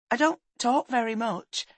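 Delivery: high-voiced, self-effacing boy; shy boy. Boy admits he's no talker, shy.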